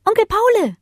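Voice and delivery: a small pup calling out; small child calling. Acted. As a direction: call after him like a little pup. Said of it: "rief Bodos Kleinster".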